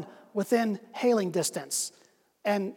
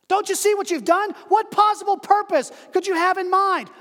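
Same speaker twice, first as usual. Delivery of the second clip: high, raised accusing voice; shouting down; 9 semitones higher, 4 dB louder than usual. Acted. Man on bridge shouts down in reproach.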